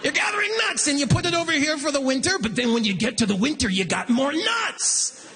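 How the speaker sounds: high, rushed banker spiel slowing on the punchline; condescending banker voice. Retold or imitated. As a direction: imitate a banker explaining to a child. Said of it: "that's the voice he's using too".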